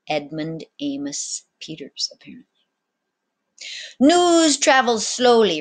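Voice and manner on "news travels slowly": loud, slow, higher captain's voice; captain's remark. A narrator's reading. Captain remarking to the boy, loud and slow. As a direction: deliver it as a worldly aside. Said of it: "remarked Captain Gallows".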